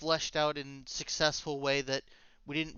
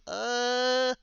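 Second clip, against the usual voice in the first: hesitant; 9 semitones higher, 6 dB louder than usual. Retold or imitated. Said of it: "and I was like".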